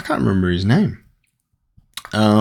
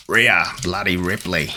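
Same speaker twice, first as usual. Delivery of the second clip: lightly put-on Australian accent; 3 semitones lower, 4 dB quieter than usual. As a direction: mildly imitate an exaggerated Australian accent. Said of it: "Australian accent when she says bloody".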